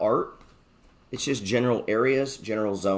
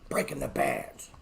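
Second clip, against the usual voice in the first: whispered catchphrase; 3 semitones higher, 7 dB quieter than usual. Shown as a demonstration. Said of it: "gotta have this ready to go".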